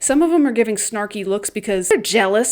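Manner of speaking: normal talking voice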